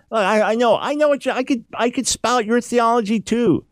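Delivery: voicing Job. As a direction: push back at the friends like fed-up Job. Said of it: "He's saying, Look, you guys talk".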